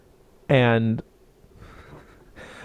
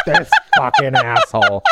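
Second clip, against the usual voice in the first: louder fake snarl breaking into a laugh; mock insult, laughing; 3 semitones higher, 5 dB louder than usual. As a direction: spit it out in mock anger, then crack up. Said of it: "And she's like".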